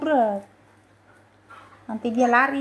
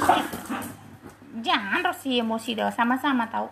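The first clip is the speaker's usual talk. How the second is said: normal talking voice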